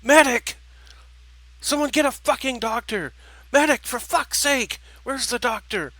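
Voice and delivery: very high, loud frantic scream for help; desperate screaming. Acted. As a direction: scream for a medic in panic.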